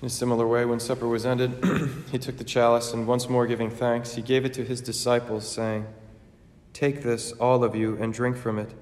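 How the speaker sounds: measured, reverent altar prayer; solemn prayer. Acted. Eucharistic prayer and consecration.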